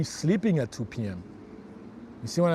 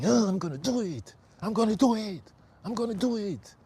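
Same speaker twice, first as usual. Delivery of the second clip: higher, pushed, determined chant; demo angry self-pep; 5 semitones higher than usual. Shown as a demonstration. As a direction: psych yourself up, fiercely, in front of the mirror. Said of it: "go in front of the mirror and to get angry".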